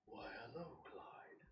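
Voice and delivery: soft voice fading to a whisper; eerie greeting. Acted. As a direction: greet them like a creepy red-eyed figure. Game master voices eerie red-eyed figures.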